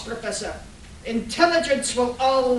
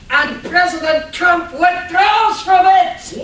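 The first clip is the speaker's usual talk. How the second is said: higher, louder, crowing rebuttal; triumphant villain shout; 5 semitones higher, 8 dB louder than usual. Acted. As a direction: hurl it like a knockout blow. Villain shouts in the debate fight.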